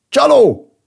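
high, loud accusing shout; sudden outcry. A narrator's reading. Villager waking from surprise to accuse, crying out loudly. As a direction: burst out with a startled, falling yell. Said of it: "harsant fel az egyik falubeli".

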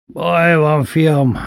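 Kálmán bácsi telling father there is trouble; urgent bandleader. Retold or imitated. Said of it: "mondta édesapának".

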